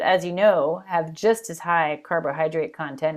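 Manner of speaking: ordinary delivery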